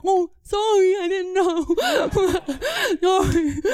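high, put-on deaf speech; pretending deafness. Child faking deafness to the warden, put-on apology. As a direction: blur the words in a high feigned voice. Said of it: "I just pretend like I'm deaf".

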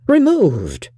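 high-pitched, falling cry of astonishment; shocked exclamation. A narrator's reading. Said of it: "cried Bingley".

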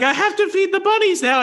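put-on high, laughing childlike voice; little sister voice. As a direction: play the little girl, high and innocent. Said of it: "and she's like".

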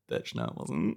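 low unvoiced whisper; mock whispered comeback; whispered retort. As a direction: hiss it under your breath. Retold clash with bank clerk.

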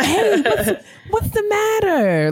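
high, slow gentle asking; coaxing a child. Retold or imitated. Asking a crying little girl what's wrong, high and gentle. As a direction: coo at a crying kid.

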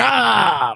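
long, high mock wail; dismayed cry.

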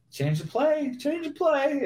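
high, pleading mock panic. Blocker pleading to the sideline, high mock panic. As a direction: beg in a squeaky panic. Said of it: "And I was like".

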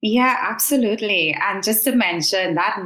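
ordinary talk

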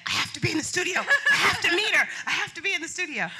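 high giddy inner squeal, laughing. Retold or imitated. Guest hoping to meet Oprah, giddy high squeal. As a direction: squeal it inside, barely containing it. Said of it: "And inside, I'm like".

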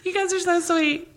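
high, fast, gushing mock excitement; gushing fangirl. Imitating herself gushing to the arrested father and son. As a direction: squeal it like an adoring fan. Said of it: "I was like".

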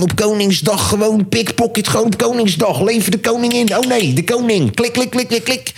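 high excited reportage impression; Boevenspotter. Thief spotter reporting on King's Day, high excited imitation. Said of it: "gaat hij een hele reportage maken".

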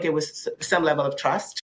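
ordinary talk, nothing marked

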